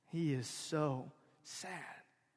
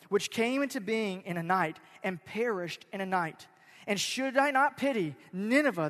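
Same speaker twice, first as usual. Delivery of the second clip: loud, measured Bible reading from the pulpit; scripture reading; 4 semitones higher, 6 dB louder than usual. Acted. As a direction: proclaim the verse to the congregation. Pastor reads scripture aloud.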